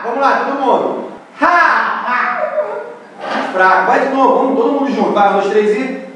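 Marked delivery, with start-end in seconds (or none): 1.4-2.4 high punchy Ha!; hammed-up TV host shout; Sérgio Malandro yell. Shown as a demonstration. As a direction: bark the catchphrase like a TV host. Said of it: "Esse é o Sérgio Malandro".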